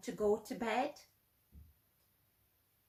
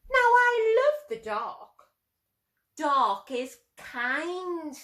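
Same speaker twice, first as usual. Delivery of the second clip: put-on fond old lady; 6 semitones higher, 5 dB louder, slower than usual. Old lady voiced fondly.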